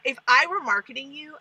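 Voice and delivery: ordinary talk, nothing marked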